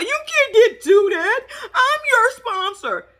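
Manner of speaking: high, slow whiny mock plea; mock crying plea. Vlogger mocking Eve begging her man, high and whiny. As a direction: imitate her begging him not to leave.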